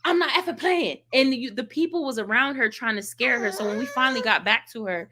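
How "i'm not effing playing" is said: mock angry cussing. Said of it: "she's cussing".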